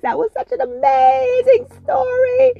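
high, tearful excitement at the story's end; moved and excited. Real, not acted. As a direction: gush with real emotion, close to tears. Reader moved after story.

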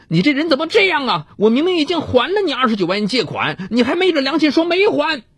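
high, fast furious scolding; scold, trembling with rage; shaking with anger. A narrator's reading. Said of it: "我气得浑身发抖，骂道".

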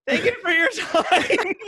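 squeaky, laughing imitation of a rattled student; high mock student voice. Comedian mimics the shaken student thanking him, squeaky and laughing. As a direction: mimic the kid, voice way up and cracking with laughter.

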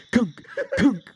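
high, loud mock thud noises; thumping sound effect. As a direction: make the kunk of a rat hitting the counter.

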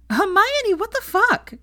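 very high, loud outburst; shocked outburst. A narrator's reading. Pansy splutters at Hermione, very high and shocked. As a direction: shriek it after the burning shot.

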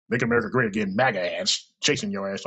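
ordinary delivery

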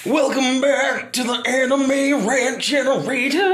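slow, drawn-out country rock announcer; high, stretched rock-singer delivery. Pretend. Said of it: "that was just my country rock voice".

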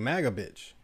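ordinary delivery

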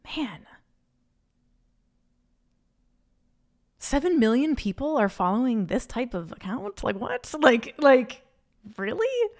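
mock-stunned, the last word shooting way up; incredulous disbelief. Telling listeners her reaction to viral accounts, incredulous. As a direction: pretend to be floored by the numbers. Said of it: "And I'm just like".

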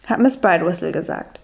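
usual speaking voice

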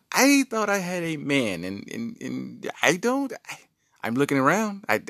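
high, sassy wife voice. Retold or imitated. Wife mocking her husband's manhood, high and sassy. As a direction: hit back at him, high and sassy. Wife's comeback, voiced in story.

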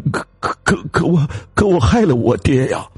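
high, stammering cry; anguished confession. Acted. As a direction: blurt the guilt in panic. Husband confesses killing father. (惊慌地哭喊)

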